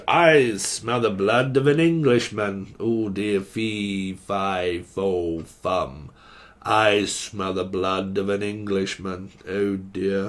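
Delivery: low, slow giant chant; giant's voice. Pretend. The giant sniffing out an Englishman, low and slow. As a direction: rumble it like the beanstalk giant.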